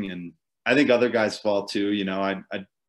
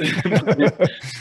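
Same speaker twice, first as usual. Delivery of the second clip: high bursting laugh; self-mocking laugh; 9 semitones higher, 6 dB louder than usual. Real, not acted. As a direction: laugh at your own blunder. Laughs at own stupidity.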